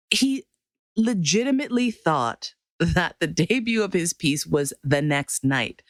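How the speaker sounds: ordinary delivery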